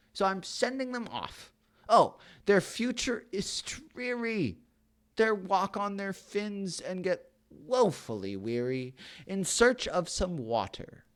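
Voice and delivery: high, crying cry then fading lament; anguished Lorax. Acted. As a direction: wail over the dying fish. Lorax cries for the fish.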